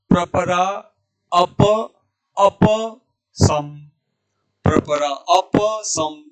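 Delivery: अप with full final vowel, सम closed on m; deliberate a-pa, then a clipped-off सम; correct recitation. Shown as a demonstration. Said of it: "इसको बोलेंगे ऐसे". (अप का प पूरा, सम का म अधूरा)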